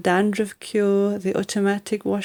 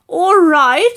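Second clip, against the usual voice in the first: a much higher, louder outraged cry; yelled; 10 semitones higher, 7 dB louder than usual. Acted. Grandma to George after the water: high outraged yell. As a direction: throw his words back in outrage. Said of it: "she yelled".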